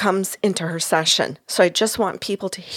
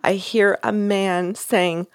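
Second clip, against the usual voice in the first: usual speaking voice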